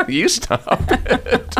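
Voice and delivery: put-on giggling childish comeback; laughing mock sibling retort. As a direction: laugh through a playful you-stop-it.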